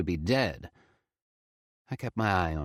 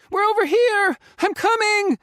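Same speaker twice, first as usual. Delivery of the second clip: high, loud shriek; 9 dB louder than usual. Acted. Faye shrieks to her grandpa in the blizzard, loud. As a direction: scream it into the wind. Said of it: "Faye shrieked into the blizzard".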